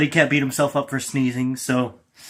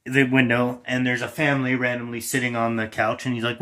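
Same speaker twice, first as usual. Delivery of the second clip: normal talking voice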